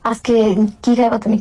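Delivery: ordinary delivery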